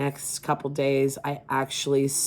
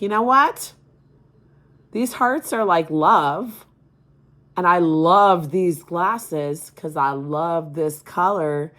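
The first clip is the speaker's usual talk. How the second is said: imitating her son; 3 semitones higher, 6 dB louder, slower than usual. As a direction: play the son gushing about his glasses. Son voiced in mom's story.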